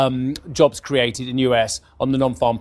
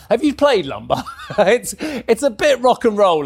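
laughing; 7 semitones higher than usual. Real, not acted. Laughing about wild lumber market.

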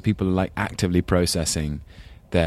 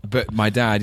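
ordinary talk

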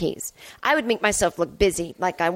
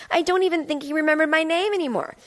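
ordinary delivery

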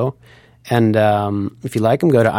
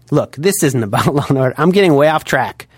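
normal talking voice

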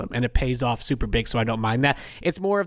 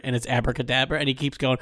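ordinary talk, nothing marked

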